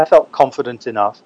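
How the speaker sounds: normal talking voice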